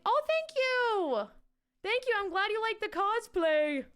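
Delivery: high, delighted thank you; squealy happy thanks; excited thanks. Real, not acted. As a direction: beam at the compliment. Thanking chat for cosplay compliment.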